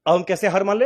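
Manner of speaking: ordinary delivery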